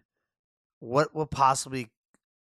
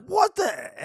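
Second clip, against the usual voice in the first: shocked; 6 dB louder than usual. Retold or imitated. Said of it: "I was like".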